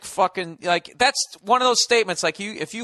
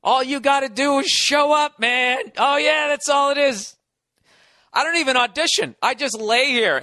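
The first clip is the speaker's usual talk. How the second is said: mocking comics; 6 semitones higher, 3 dB louder than usual. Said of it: "they just go".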